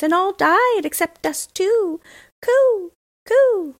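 high, loud cooing pigeon voice; cooing wood pigeons. A narrator's reading. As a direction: coo it out like a little bird, high and slow. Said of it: "Then the wood pigeons said".

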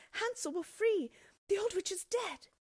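high, loud triumphant shout; joyful cry. Acted. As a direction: shout the good news with relief. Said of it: "crying out".

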